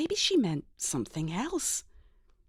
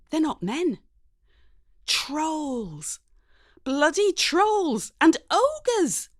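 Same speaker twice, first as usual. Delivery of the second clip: horrified; 8 semitones higher, 4 dB louder, much slower than usual. A narrator's reading. Horrified realisation at screen.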